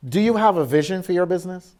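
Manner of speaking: normal talking voice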